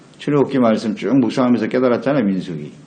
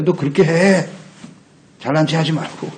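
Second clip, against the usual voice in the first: higher-pitched egging on; a tempter's raised-voice taunt; 4 semitones higher than usual. Acted. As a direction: needle them into giving in. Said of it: "여기에 넘어간다고".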